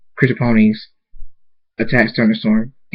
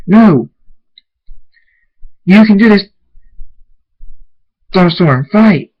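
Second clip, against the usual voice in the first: loud, high rallying shout; desperate urging; 9 semitones higher, 6 dB louder than usual. A narrator's reading. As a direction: shout at a companion to keep going. Desperate urging of a struggling companion.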